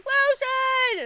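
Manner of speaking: high, loud Wilson cry; excited shout. Real, not acted. Scene: streamer remembers the volleyball's name, high shout. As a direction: call it out like in the movie.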